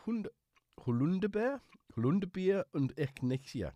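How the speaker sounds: attempted German. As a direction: try the German, unsure of it. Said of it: "is that German?".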